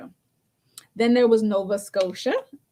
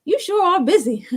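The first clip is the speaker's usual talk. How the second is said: higher, put-on voice of the client; client's voice; 9 semitones higher, 4 dB louder than usual. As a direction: lightly imitate a teasing client. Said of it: "He said".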